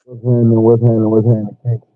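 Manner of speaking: talking through a tunnel; muffled, hollow tunnel sound; echoing tunnel. Real, not acted. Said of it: "you sound like you're talking through a tunnel".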